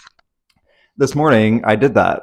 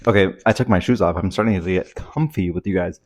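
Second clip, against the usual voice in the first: ordinary delivery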